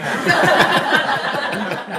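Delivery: big loud high burst of laughing; loud laughter. Real, not acted. Telling the family about grandpa's shotgun, howling laughter. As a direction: crack up hard at the punchline.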